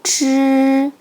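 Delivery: 치 as a retroflex "zh", tongue curled up. Shown as a demonstration. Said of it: "지읒을 혀를 올리고 발음해 보세요".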